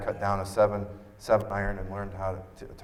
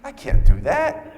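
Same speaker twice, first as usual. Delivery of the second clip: higher, louder, slow protest; dismayed protest; 11 dB louder, slower than usual. Retold or imitated. John telling his wife the ride is impossible, in slow, higher dismay. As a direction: throw up your hands in disbelief.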